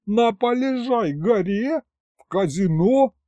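very high, astonished questions; incredulous. A narrator's reading. Boss hearing about the casino, incredulous. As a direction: repeat it as if it cannot be true.

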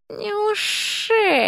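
high, slow, soft amused drawl; a sly serpent lady teasing; amused snake woman. A narrator's reading. Snake lady teasing the dean, slow amused doubt.

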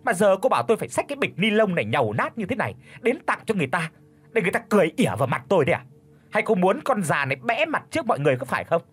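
high, fast scolding mother-in-law; hissing reproach. Acted. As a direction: berate her shrilly and quickly. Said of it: "gằn giọng chỉ chiết". (mẹ chồng gằn giọng mắng con dâu, cao và nhanh)

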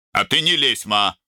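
high, rough snap; rude rebuke. A narrator's reading. Son telling his mother to stay out, rudely snapped. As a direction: cut her off rudely. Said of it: "грубо осадил её Арун".

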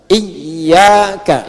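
"Iyyaka" drawn out with doubled y; model reading. Shown as a demonstration. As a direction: hold the y of "Iyyaka" for pupils to copy. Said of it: "Ayo baca". (contoh "Iyyaka" dengan tasydid yang ditahan)

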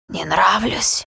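changed voice. A narrator's reading. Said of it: "уже совершенно иным голосом вопросила тьма".

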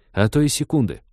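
ordinary delivery

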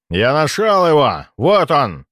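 loud triumphant shout. Acted. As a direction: call out in triumph. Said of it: "выкрикнул тот самый маг".

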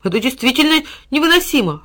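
high, loud exasperated outburst; irritated. A narrator's reading. Boy exasperated by his dog. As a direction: throw up your hands in exasperation. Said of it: "раздражённо всплеснул руками Тильтиль".

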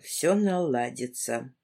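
low, slow bitter repeat of Всё наладится; bitter echo. A narrator's reading. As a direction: echo her mother's words with disbelief. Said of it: "Чёрта с два у нас всё наладится". (горько и медленно, ниже: «Всё наладится»)

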